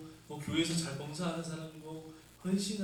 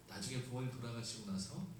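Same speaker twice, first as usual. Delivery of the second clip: ordinary talk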